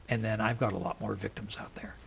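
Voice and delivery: smug killer taunting the detective in a whisper; killer's whisper. Retold or imitated. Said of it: "the guy says".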